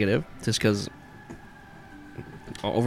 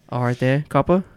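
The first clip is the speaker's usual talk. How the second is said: teasing; 4 semitones higher, 5 dB louder than usual. Pretend. [name] taunting the cop.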